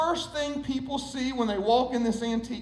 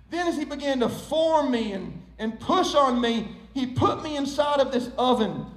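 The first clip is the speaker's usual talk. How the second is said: ordinary talk, nothing marked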